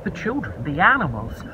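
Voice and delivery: ordinary delivery, nothing marked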